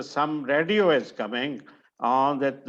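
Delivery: usual speaking voice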